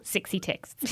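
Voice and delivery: put-on light New Zealand accent on sexy texts. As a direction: do a playful Kiwi accent. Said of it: "that is mocking a New Zealand accent".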